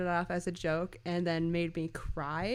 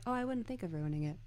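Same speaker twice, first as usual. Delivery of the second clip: quick, understated imitated deadpan; brisk dry delivery, no reaction; 4 dB quieter, faster than usual. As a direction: toss it off drily without emphasis. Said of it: "just his deadpan".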